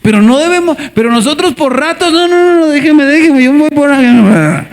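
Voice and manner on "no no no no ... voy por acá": high mock whine of no no no; stubborn drunken refusal. Believers refusing the Spirit, high mock drunken protest.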